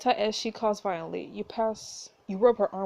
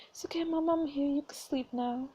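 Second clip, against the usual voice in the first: hushed, higher, slowing comfort; tender reassurance; 4 semitones higher, 4 dB quieter than usual. Acted. Daughter comforts her dying mother, tender. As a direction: soothe softly, close to whispering.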